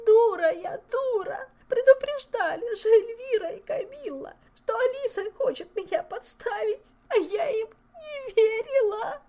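high, loud sobbing lament; sobbing wail. A narrator's reading. Viscountess sobbing over her own foolishness. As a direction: wail it out through tears. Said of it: "И зарыдала, причитая".

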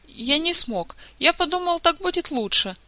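high-pitched, apologetic admission; sheepish excuse. A narrator's reading. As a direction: confess weakly in your own defence. Sheepish man excusing his failure.

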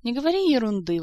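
acted affronted retort; indignant. A narrator's reading. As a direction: brush the accusation off indignantly. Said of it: "возмутилась Эльвира".

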